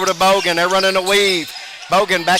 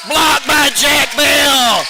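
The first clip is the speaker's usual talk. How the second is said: slow, drawn-out, loud and much higher shout; excited call; 7 semitones higher, 4 dB louder, much slower than usual. Real, not acted. Announcer hypes Jack Bell's block with a drawn-out shout. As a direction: stretch the call out to hype the block.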